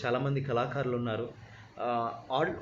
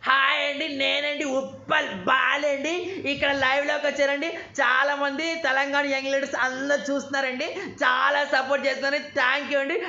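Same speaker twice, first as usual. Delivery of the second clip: high, loud excited comic voice; bubbly high-pitched celebrity greeting; imitating Uppal Balu, much higher and louder; Uppal Balu impression; 12 semitones higher, 5 dB louder than usual. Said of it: "వాళ్ళ కోసం సరదా చేసి చూపిస్తాను".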